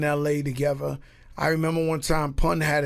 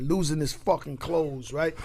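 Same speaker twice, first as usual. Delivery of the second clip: ordinary talk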